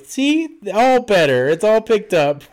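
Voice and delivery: high, chirpy fake-nice nanny; high sweet nanny voice.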